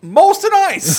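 high, loud cartoon Canadian voice; a hammed-up Canadian from up north; mock Canadian accent. Telling Justin where he lives, in a mock Canadian accent.

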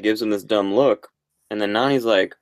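normal talking voice

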